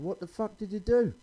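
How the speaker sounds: high, startled outburst; shocked shout. Retold or imitated. Comic asking his ex what happened, shocked. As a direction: gasp it out in disbelief.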